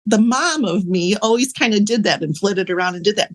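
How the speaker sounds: ordinary delivery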